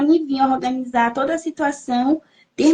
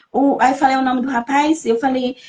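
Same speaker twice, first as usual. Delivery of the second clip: usual speaking voice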